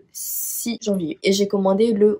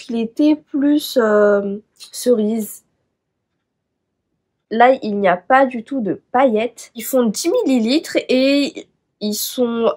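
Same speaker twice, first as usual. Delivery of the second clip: usual speaking voice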